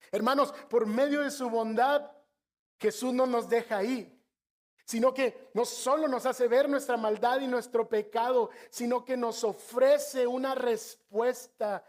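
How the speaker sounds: preacher urging the brothers to see their sin; fervent preaching. Acted. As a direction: preach it to the congregation with force. Preaching the gospel.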